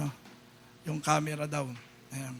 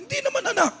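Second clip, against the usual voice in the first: father's voice; 14 semitones higher, much faster than usual. Retold or imitated.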